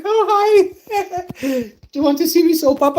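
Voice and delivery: very high, loud coquettish falsetto; flirty wife voice. Retold or imitated. Wife in the shower teasing the streamer, high flirty falsetto. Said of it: "and she's like".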